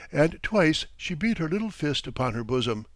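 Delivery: usual speaking voice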